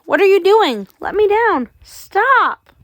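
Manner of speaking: put-on girl screaming as she's lifted to the window. A narrator's reading. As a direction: scream at them to stop. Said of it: "I screamed".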